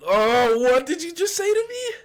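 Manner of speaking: high-pitched shocked squeal; mock outrage. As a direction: squeal it in fake disbelief.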